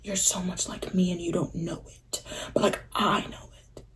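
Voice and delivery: hushed, private whisper; soft breathy whisper to yourself; whispered thought. Retold or imitated. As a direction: say it under your breath like a secret. Inner thought about meeting her.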